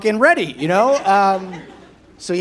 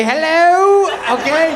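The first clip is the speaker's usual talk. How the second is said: a high, louder cheery hello; breezy gym greeting; 8 semitones higher, 5 dB louder, slower than usual. Retold or imitated. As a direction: chirp it as you walk in. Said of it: "I say".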